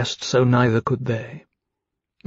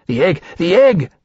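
higher, louder, urgent cry; alarmed exclamation; 5 semitones higher, 5 dB louder than usual. A narrator's reading. Temeraire spots the stolen egg.

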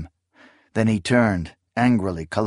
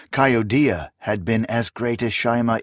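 plain reading voice

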